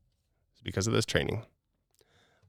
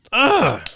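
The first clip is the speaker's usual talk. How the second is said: loud, high "ugh" of anger; exasperated groan; 13 semitones higher, 8 dB louder than usual. Shown as a demonstration. Demonstrates being really pissed, exasperated groan. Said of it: "like, really pissed out".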